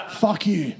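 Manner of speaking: ordinary talk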